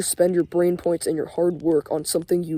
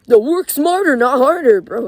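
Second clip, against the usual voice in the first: high, loud sneering parody of the saying; mock it like a know-it-all; mocking quote; 6 semitones higher, 6 dB louder than usual. Scoffing at people who say work smarter, sneering imitation. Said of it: "what the fuck".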